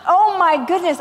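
mock horrified refusal; scandalised preacher's daughter; panicked. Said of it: "So I was like".

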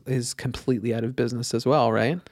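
usual speaking voice

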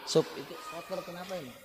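soft, quick friendly question; calm asking. Shown as a demonstration. Mosque caretaker gently asking a worker about the dirt, soft and friendly. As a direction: ask the worker kindly, no edge. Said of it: "tak panggil".

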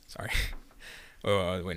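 usual speaking voice

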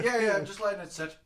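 laughing through a mock Irish accent; Irish barman impression, giggling; a hint of Irish barman, laughing. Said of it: "that's a poor fucking accent".

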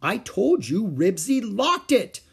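put-on high, loud, slow child's shout. A narrator's reading. Little girl shouting at the others, insistent. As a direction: shout it, told-you-so. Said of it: "Ramona shouted".

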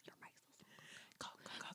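unvoiced hushed warning; whispering. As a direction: whisper it urgently.

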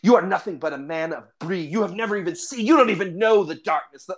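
impassioned fantasy-hero rebuke; scornful, indignant ranger. Acted. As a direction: berate a naive villager with rising heat. Ranger berates the man of Bree.

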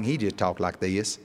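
imitating a light North Carolina twang; Southern father's twang, lightly imitated. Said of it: "he's got a twang".

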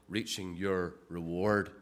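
"your" set apart before "reward"; low-pitched, word-by-word "reaching your reward"; stress on the personal. Shown as a demonstration. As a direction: lean on "your", low and deliberate. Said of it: "it's the emphasis".

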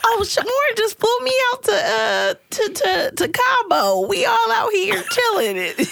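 put-on high-pitched show-off girlfriend voice; bragging friend. Friend boasting about a trip, high mock bragging.

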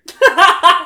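a burst of loud, high laughter. Real, not acted. Laughing at her own remark.

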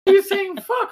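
high-pitched mock-mom exasperation; exasperated mother. Mother scolding her swearing son at dinner, exasperated.

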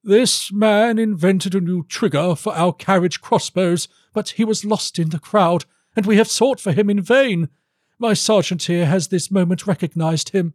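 high, formal courtier's voice; ceremonious, high-pitched baron; Baron Ingolf. Acted. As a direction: report to the king in a put-on high voice.

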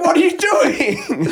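high, slow, laughing disbelief; nervous laughing. Retold or imitated. De Niro's character laughing nervously at Hoffa, high laughing voice. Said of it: "nervous laughing".

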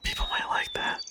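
hushed whisper. Pretend. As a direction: whisper it playfully. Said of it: "Should we whisper the whole episode?".

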